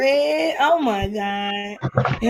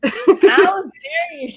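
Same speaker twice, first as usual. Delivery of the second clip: laughing protest; 7 semitones higher than usual. Real, not acted. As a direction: insist while cracking up. Laughing recalling the fall.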